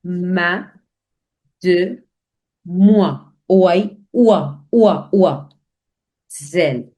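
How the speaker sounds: Mademoiselle split as "Ma", "de", "moi", "oua", "Zel"; syllable by syllable. Shown as a demonstration. Teacher splitting Mademoiselle into syllables for learners. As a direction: break Mademoiselle into separate syllables. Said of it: "So let's break it and understand".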